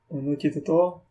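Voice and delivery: normal talking voice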